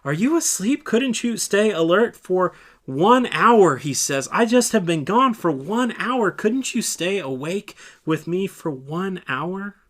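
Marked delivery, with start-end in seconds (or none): 0.0-3.8 urgent reproach. Acted. Leader scolding disciple who fell asleep, loud reproach. 4.3-9.8 higher, louder, slowing at the end; pleading reproach. Acted. Leader asking disciple to stay awake, pleading reproach.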